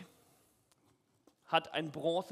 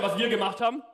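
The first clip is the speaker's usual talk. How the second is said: ordinary talk, nothing marked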